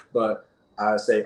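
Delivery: ordinary delivery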